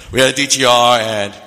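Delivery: loud, level couple's announcement; public announcement. Retold or imitated. College couple telling the fellowship they are dating, public announcement. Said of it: "They make a public announcement".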